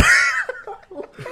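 high screaming laugh; shrieking laugh. Real, not acted.

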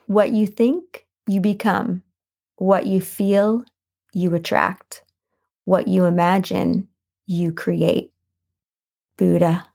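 slow, measured phrases with pauses between; inspirational quote reading. Acted. Host dictating a Buddha quote to journaling listeners, slow and deliberate. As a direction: rise on each setup, settle down on the answer.

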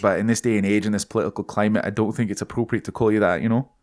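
reasoning in a put-on Scottish lilt; Scottish accent. [name] declines to call co-hosts lasses, Scottish accent. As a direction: explain politely as a Scot. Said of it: "totally turned into someone".

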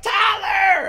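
high, stretched holler; mock calling out. Friend driving by a walker, hollering the name. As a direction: imitate a friend yelling from a passing car.

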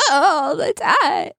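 high, slow, deliberately cracking squeak; mock voice crack. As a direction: force the voice to break like a goat bleat. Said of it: "voice crack demonstration that you just did".

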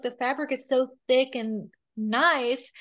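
ordinary delivery

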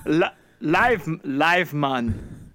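hesitant Caribbean voice; hammy island lilt on mon; Caribbean accent. Pretend. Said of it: "a Caribbean accent?".

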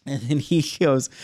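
ordinary talk

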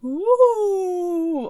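high drawn-out ghostly ooh; ghost moan. Pretend. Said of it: "Oh, that's terrible".

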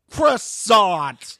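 drawn-out mocking "Croissant"; mock dismissive "Croissant". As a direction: say "Croissant" like a withering put-down. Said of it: "And I was like".

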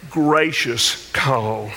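low, slow, drawn-out words; gentle, gracious. Real, not acted. Contrasts gracious call with urgent one.

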